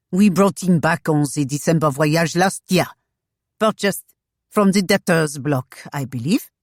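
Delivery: put-on French-accented officer breaking in; French-accented gentleman, matter-of-fact; French husband. Jean's French accent in story.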